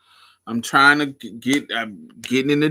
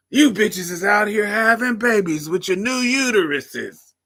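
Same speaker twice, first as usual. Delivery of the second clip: put-on much higher crotchety old lady; 10 semitones higher, 6 dB louder than usual. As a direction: squawk like a cranky granny. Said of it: "I wanna be talking shit".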